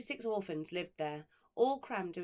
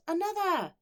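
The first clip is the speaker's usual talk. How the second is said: high, loud eager shout; crying out; 11 semitones higher, 9 dB louder than usual. Acted. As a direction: call out for more. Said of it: "would cry out".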